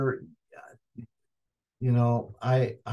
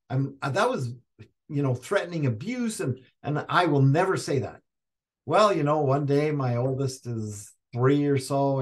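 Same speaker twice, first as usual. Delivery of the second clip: ordinary talk, nothing marked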